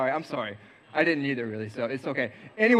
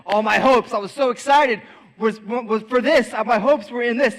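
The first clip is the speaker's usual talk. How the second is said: higher and louder, riding the excitement; so excited; 4 semitones higher, 5 dB louder than usual. Retold or imitated. Telling the youth group his hopes, excited. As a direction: hopes running high, let it swell.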